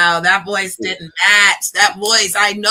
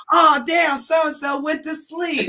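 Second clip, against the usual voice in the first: imitating host; 7 semitones higher, slower than usual. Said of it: "and he was like".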